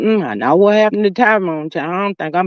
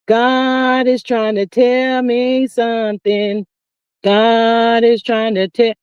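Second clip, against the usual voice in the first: slow realization; much slower than usual. Retold or imitated. Retelling her own realization.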